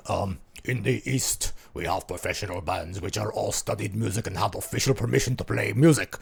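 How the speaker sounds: put-on low, lingering German accent; movie villain German accent. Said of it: "I'm going to do a German accent".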